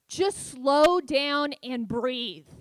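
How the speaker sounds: very slow, loud and drawn out; patronizing advice. Retold or imitated. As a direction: dole out advice syllable by syllable. Mocking people's unwanted advice.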